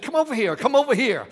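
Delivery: high, loud call across the store; retold yell. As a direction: call her over excitedly. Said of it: "I yelled and called her name".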